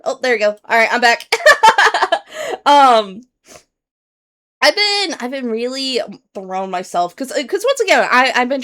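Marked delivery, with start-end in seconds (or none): 1.3-2.6 short high giggle; sheepish laugh. Real, not acted. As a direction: let out a bright embarrassed laugh. Laughs after the stream froze.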